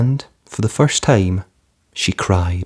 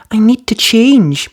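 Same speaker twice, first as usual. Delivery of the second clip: Cinderella's voice; 13 semitones higher, 5 dB louder, slower than usual. A narrator's reading. Cinderella to fairy.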